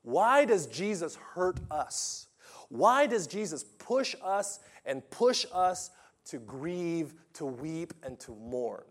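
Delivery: drawn-out, rising preacher's cry; impassioned pulpit questions, slow and swelling; preaching at full force. Acted. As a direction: preach it slow and loud to the congregation. Preacher builds rhetorical questions.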